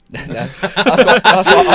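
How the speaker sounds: laughing. Real, not acted. Laughing at the clapping story.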